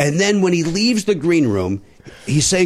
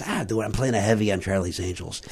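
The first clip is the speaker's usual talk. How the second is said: mild offhand mumble; 5 semitones lower, 8 dB quieter than usual. Retold or imitated. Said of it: "And he goes".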